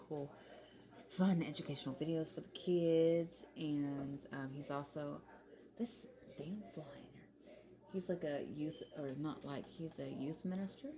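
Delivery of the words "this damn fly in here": muttered aside. Real, not acted. Annoyed aside about fly.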